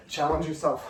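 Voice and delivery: usual speaking voice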